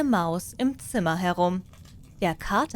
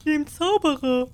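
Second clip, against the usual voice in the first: loud, higher voice of field workers; peasants answering; 7 semitones higher, 4 dB louder than usual. Acted. Farmhands shout back to the cat, loud and high. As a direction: call back as the mowers in the meadow.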